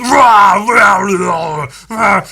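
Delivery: loud high roar; beast roar. Retold or imitated. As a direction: roar at the frightened man. Beast roars at translator.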